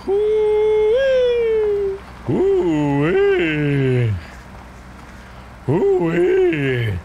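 loud, high "hoo-wee" whoop; long swooping whoop up high. Pretend. Player whooping over the game, loud high whooping. Imitated whooping noise while playing.

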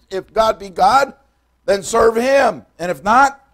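high, loud pulpit preaching, slowing on the challenge; fiery preaching. Acted. Preacher thundering Elijah's challenge to the people, loud and high. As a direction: drive it home to the congregation.

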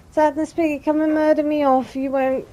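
high loud taunt. Real, not acted. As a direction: dare the enemy to attack. Taunting the Sadness Piggy enemy.

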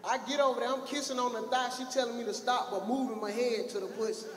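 ordinary delivery

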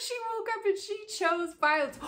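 tossed off with glee; gleeful high-pitched callout; excited high retort. Real, not acted. Excited laughing repeat.

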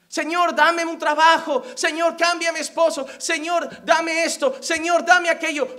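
loud, pressing mock prayer; insistent loud petition. Self-seeking believer asks God for favours, loud insistent prayer. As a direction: demand each favour in a strong voice. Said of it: "pero esa parte la dicen bien flojito".

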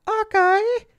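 very high, loud mimicked squeal; really high-pitched voice. Streamer mimicking a large flamboyant man, high-pitched voice. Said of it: "in, like, a really high-pitched voice".

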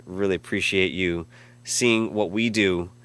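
usual speaking voice

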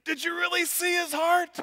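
put-on very high, squealing little girl, shouting the end. Four-year-old daughter chiming in, very high and shouting. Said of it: "She said".

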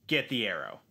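ordinary talk, nothing marked

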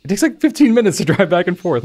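high, loud, incredulous complaint; exasperated protest. Retold or imitated. Player complaining to the game after losing logs, exasperated.

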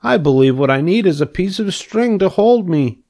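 a lightly voiced hopeful pig; eager young pig. Wilbur planning a string for his web, hopefully.

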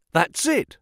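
high, eager cry of agreement; excited cry. Acted. Pycroft agreeing about the detectives, excited cry. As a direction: jump on the idea. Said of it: "cried Pycroft".

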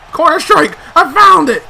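high, loud relieved cry; triumphant shout. Real, not acted. Finally found the play.

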